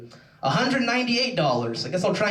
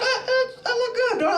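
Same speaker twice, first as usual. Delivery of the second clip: put-on hurt girlfriend. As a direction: imitate her in a tiny high stammer. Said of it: "And she's like".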